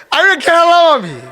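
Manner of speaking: high, loud firm declaration; insistent cry. Acted. The Prophet answering the unbelievers in a high, loud insistent cry. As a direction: insist on it with full voice.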